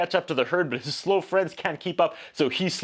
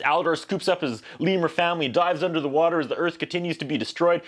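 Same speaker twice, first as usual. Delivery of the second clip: ordinary delivery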